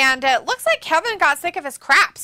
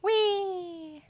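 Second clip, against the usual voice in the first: mock whee; 6 semitones higher, 8 dB quieter than usual. Mocking a fall.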